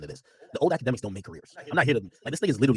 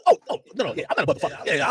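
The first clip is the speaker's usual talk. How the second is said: normal talking voice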